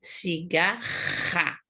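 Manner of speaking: "Cigarra" stretched slowly, throaty h-like RR; "Cigarra" with a long guttural R; strong double R. Shown as a demonstration. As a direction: draw out "Cigarra" syllable by syllable, rasping the RR. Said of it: "pra ter o som forte".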